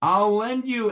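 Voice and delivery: lightly voiced peasant peddler; supposed Vasil. A narrator's reading. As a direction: answer emphatically, high and loud. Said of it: "answers the supposed Vasil".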